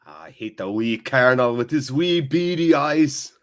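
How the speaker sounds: hammed-up comic Scottish rant; exaggerated mock-Scottish grumble; Michael Myers's Scottish. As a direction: rant it in a broad, badly faked Scottish accent. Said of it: "sounding like Michael Myers is Scottish".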